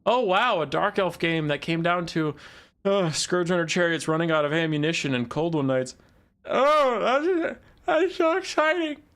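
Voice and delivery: oversell it until it sounds absurd; mock-thrilled caster gushing over the same old build; sarcastic excitement. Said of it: "I was so excited about the same Dark Elf build".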